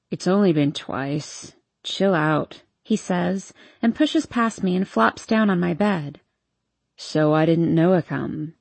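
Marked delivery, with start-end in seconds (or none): 0.1-2.6 put-on low, slow, lazy drunk; a hint of a drunk young man; town drunk. Hardin's drunk voice. 7.0-8.5 put-on low, slow, lazy drunk; a hint of a drunk young man; town drunk. Hardin's voice.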